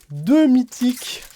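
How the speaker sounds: high, loud, drawn-out amazement; excited exclamation. Real, not acted. Opening cards and finding two mythics, excited exclamation. Excited reaction to card pull.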